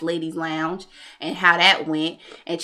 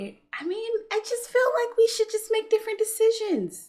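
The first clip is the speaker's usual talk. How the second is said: high mock innocence; 14 semitones higher, 3 dB quieter than usual. Mock diplomatic evasion.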